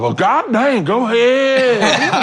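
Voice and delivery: high, slow, hammed-up Southern belle voice laughing; mock Southern drawl. Doing a Texas guy's Southern mama, high slow hammed-up Southern accent. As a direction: camp it up like a Southern mama gushing. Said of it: "my mama would love".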